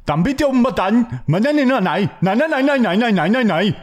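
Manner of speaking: high, singsong gibberish in a mock Indian accent. Host does an accent for co-host to guess, mock Indian accent. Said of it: "No, it's fucking Indian".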